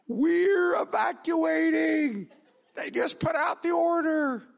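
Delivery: high, loud, slow mock shout of his panicked wife; frantic wife. Pastor imitating his wife phoning in panic, high laughing shout. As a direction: hams up his wife yelling evacuate through laughter. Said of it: "my wife blowing up my phone saying".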